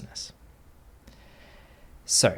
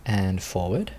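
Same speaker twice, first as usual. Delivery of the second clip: guided meditation. Acted. Teacher settling students into posture, calm guided meditation voice. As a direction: ease the group gently into stillness.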